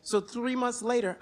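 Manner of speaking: usual speaking voice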